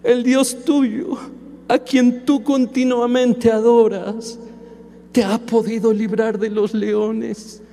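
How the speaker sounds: crying out, slow and grieving; grieving king calling down into the lions' den; sad, crying call. Acted. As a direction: call his name through tears, slowly. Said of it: "con su voz triste".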